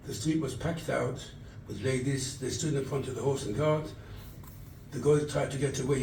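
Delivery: ordinary talk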